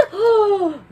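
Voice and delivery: high gasping breath; dramatic gasp. A narrator's reading. Storyteller gasping at the cliffhanger. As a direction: gasp in mock alarm.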